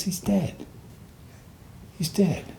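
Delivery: high, soft near-whisper; hushed report. Retold or imitated. Sergeant telling soldier his comrade is dead, high hushed voice. Retold sergeant breaking news of death.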